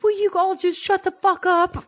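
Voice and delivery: high, slow fed-up outburst; mock snap at everyone; exasperated. Friend imitating Harley telling the fighters to stop. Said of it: "Harley's like".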